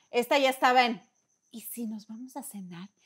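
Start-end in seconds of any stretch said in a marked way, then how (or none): 1.5-2.9 hushed, knowing invitation to dinner; quiet conspiratorial hint; sly quiet suggestion. Retold or imitated. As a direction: drop the idea softly, like a schemer. Friend slyly leaving couple alone.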